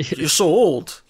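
drawn-out emphatic mock insult; mock blunt. Linda bluntly tells her suitor off, mock blunt. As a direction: blurt it out tactlessly, hammed up a little.

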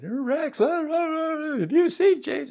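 high, flustered stammering; a worried mom who can't get the words out; panicked mother. Retold or imitated. Mother phoning her husband about their son, high panicky stammer. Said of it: "she called her husband and she said".

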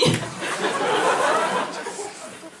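high unvoiced laughter; burst of laughter. Real, not acted. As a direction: laugh breaks out. Laughs after the accusing line.

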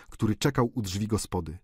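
plain reading voice

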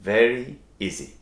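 ordinary talk, nothing marked

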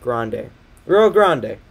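slow GRANDE, as "GRAHN-day"; corrected GRANDE. Real, not acted. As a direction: sound out GRANDE slowly with "ah" and "ay". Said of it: "forget how to pronounce Grande".

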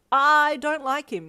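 high, loud, drawn-out flustered denial; nervous denial. A narrator's reading. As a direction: deny it nervously, stretching the start. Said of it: "you stammered nervously".

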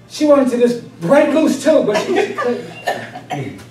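slow, laughing through the words; fighting real laughter; laughter spilling into the line. Real, not acted. Mother holding back laughter.

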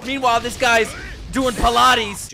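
loud, high-pitched incredulous outburst; hysterical disbelief. Real, not acted. Viewer reacting to absurd anime fight, hysterical disbelief. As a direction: yell it through rising laughter.

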